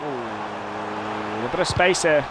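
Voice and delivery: steady football commentary call; live play-by-play. Acted. Gamer voicing commentary over his own match, play-by-play. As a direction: narrate the action like a TV commentator.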